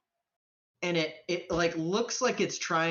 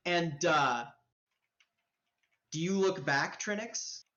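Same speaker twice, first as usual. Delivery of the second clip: usual speaking voice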